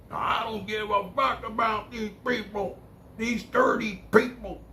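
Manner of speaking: high, strained mock rant; imitating son's rant. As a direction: squeak out the son's tirade. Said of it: "he went off on the family".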